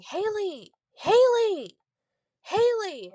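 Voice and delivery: high, drawn-out calling from downstairs; mock mom calling for her kid; imitated yelling. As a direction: call the name out long and high, falling at the end. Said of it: "she was yelling for me".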